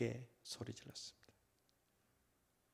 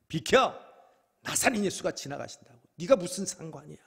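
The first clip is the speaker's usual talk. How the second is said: irritated louder bark at a beggar; annoyed; 6 semitones higher, 8 dB louder than usual. Acted. Passerby snapping at blind Bartimaeus, irritated and louder. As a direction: brush him off, annoyed. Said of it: "귀찮은 듯".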